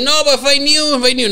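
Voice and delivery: mock skeptic brushing it off as fake news; mocking doubter. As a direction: scoff it off like a know-it-all. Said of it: "Yo, me va a decir".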